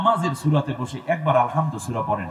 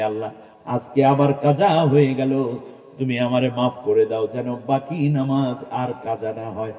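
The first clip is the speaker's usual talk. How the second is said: normal talking voice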